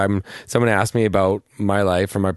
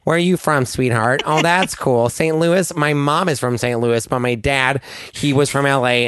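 higher, nasal LA small talk; nasal LA accent; 4 semitones higher than usual. Shown as a demonstration. Guest playing out LA small talk in a nasal LA accent. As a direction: chat through your nose like an Angeleno. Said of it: "I'm trying to do that".

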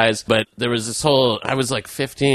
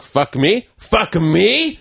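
rising indignant challenge, pitched up; mock a coworker squaring up; hammed-up outraged line cook; angry coworker; 8 semitones higher, much slower than usual. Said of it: "he was like".

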